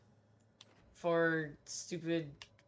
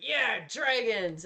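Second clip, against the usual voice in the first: drawn-out excited cheer, higher and louder; hyped cheer; 6 semitones higher, 5 dB louder, slower than usual. Retold or imitated. Gamer retelling her hype for dragons, excited cheer. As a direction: cheer like a kid promised dragons. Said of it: "I was like".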